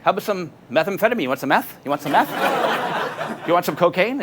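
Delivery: mock the twitchy guy pushing drugs; imitating pushy stranger.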